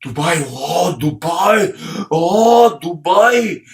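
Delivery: exaggerated oohing and aahing over a name; mock awe. Seventies Indian hearing of Dubai, mock awestruck. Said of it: "if you are an Indian in the seventies and say".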